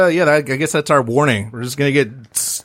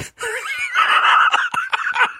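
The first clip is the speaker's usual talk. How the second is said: evil laugh. Acted. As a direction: cackle like a cartoon villain. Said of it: "that's real evil".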